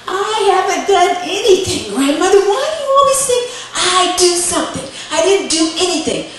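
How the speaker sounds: high, loud protest; indignant child's denial. Acted. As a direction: whine back as the wrongly accused kid. Boy protests his innocence.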